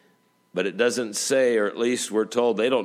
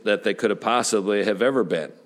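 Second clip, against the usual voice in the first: ordinary talk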